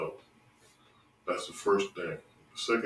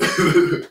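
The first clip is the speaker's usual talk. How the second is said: short, loud, high burst of laughter; real laugh, high and quick; amused laugh; 10 semitones higher, 14 dB louder than usual. Real, not acted. Host amused after naming his show, laughing.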